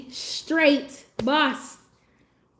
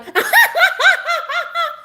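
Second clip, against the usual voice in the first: a hammed-up high cackle, held long; mock his fake attention-seeking laugh; imitating boss's laugh; 7 dB louder than usual. Streamer mimics boss laughing for attention, hammed-up high cackle. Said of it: "And he'll be like".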